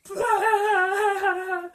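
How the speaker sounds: loud, high imitated guffaw; exaggerated mocking belly laugh; mock uproarious laughter. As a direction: burst out imitating friends dying laughing. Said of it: "dying fucking laughing at me".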